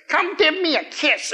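high, slow cooing grandma voice; old auntie voice. Retold or imitated. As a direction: coo it like a doting old aunt. Smothering old relatives at reunion.

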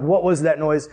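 ordinary delivery

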